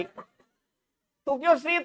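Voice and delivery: teasing laugh. Real, not acted. Teases laughing student on phone.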